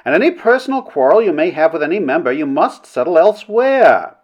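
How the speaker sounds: high, imperious scolding; haughty chairwoman laying down the rules; stern princess. A narrator's reading. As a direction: reprimand from the chair.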